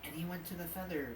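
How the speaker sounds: soft storytelling voice. Acted. GM narrates in character.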